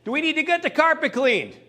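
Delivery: husband calling to his wife in the kitchen. Retold or imitated. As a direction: call her from across the house, half acted. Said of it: "He yells to his wife".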